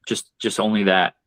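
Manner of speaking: usual speaking voice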